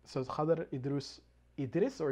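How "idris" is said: Idris with an "ee-ris" ending; variant pronunciation. Shown as a demonstration. Checking a filmmaker's name, Idris ending in "-ris". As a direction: say Idris, second vowel as in "hiss".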